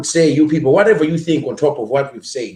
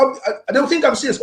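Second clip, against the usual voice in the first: ordinary talk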